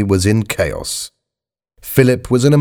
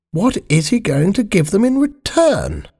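high-pitched Queen, thinking to herself; high royal musing; 12 semitones higher than usual. Acted. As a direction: voice a Queen in a far higher register. Voices the Queen's thought.

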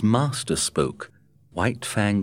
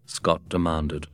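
ordinary delivery, nothing marked

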